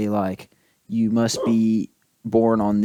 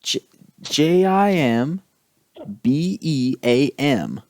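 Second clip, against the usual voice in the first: slow letter-by-letter spelling; spelled out slowly; slower than usual. Shown as a demonstration. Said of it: "spelling the name".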